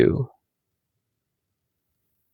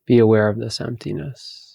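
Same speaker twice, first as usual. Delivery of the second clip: slow, spacious meditation voice; guided meditation. Acted. Teacher guiding listeners to empty their thoughts, guided meditation. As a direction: lead them gently inward, with long pauses.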